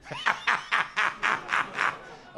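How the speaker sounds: airy high-pitched sidekick laugh; wheezing high radio laugh; high breathy cackle. Shown as a demonstration. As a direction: cackle like the zoo laughing guy. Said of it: "if I were to do an impression of that".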